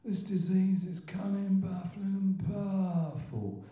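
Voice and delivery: put-on high, drawn-out old-timer's voice; Len B impression. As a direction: stretch the words slowly in an old Croydon character's accent. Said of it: "he had this amazing accent".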